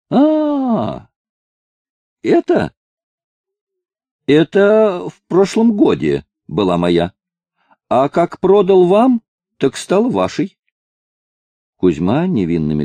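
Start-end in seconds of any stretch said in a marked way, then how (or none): 0.1-10.6 put-on high, innocent old man; feigned innocence. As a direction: act like you just noticed. (наивно, притворно)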